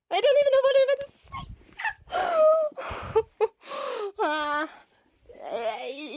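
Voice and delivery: fast, high laughing babble; nervous laughing. Real, not acted. Author laughing nervously at her own rambling.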